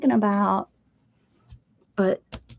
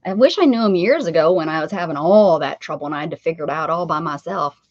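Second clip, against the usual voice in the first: ordinary talk, nothing marked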